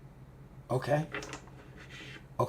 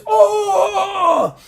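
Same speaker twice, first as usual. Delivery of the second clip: long, very high acted scream; pained scream. Game master as big guy bitten by fanged one, screaming. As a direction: wail out as if bitten. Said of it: "The guy just screams".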